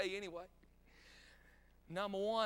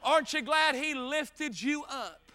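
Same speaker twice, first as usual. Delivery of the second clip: loud, slow, raised preacher's call; full-force preaching; 5 semitones higher, 6 dB louder, much slower than usual. Acted. Preacher rousing the congregation about being lifted up, full-force preaching. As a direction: ring it out to the congregation.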